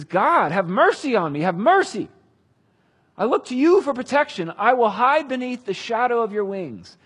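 high, loud, urgent plea; crying out for mercy. Acted. David begs God for protection, crying out. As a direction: plead upward with full voice. Preacher voices David's desperate prayer.

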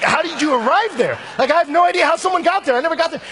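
loud, very high, incredulous outburst; hammed-up bewildered excitement at full volume; amazed curiosity. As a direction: exclaim in exaggerated amazement, voice shooting up. Acted amazed questioning of oboist.

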